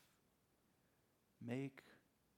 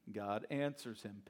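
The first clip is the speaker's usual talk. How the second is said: usual speaking voice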